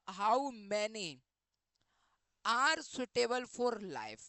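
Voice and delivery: the right phrase read very slowly; slow corrected reading. Shown as a demonstration. Teacher reads out the corrected sentence slowly. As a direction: dictate the corrected words slowly. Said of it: "ये होना चाहिए था".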